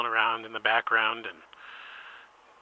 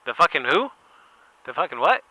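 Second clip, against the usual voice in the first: Brian's voice; 4 semitones higher, 6 dB louder than usual. Retold or imitated. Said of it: "And he's like".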